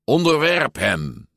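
high, emphatic stern order; mock-commanding, pitched well up. A narrator's reading. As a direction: order it like a strict coach. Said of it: "zei Alberto streng".

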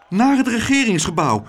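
high, loud urgent gasp; panting. A narrator's reading. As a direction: pant it out in a hurry. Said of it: "hijgde juffrouw Klaterhoen".

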